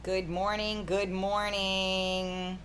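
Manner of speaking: stretched-out, sing-out good mornings; drawn-out greeting. Real, not acted. Host greeting viewers as the stream opens, drawn-out. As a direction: draw each morning out long and slow.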